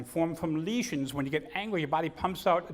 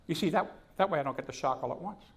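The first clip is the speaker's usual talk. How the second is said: ordinary delivery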